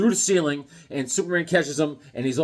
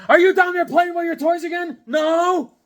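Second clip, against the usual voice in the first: much higher, louder nagging voice; scolding mom voice; 13 semitones higher, 6 dB louder than usual. Pretend. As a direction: shriek it like a parent at the basement door. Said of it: "Hulk was like".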